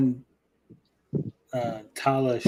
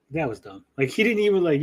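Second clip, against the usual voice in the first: normal talking voice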